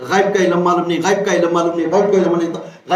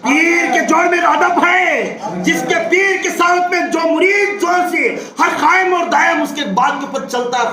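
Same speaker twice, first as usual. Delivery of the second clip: impassioned preaching; 8 semitones higher than usual. Real, not acted. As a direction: stretch out the phrases and ring them out. Preaches at full force.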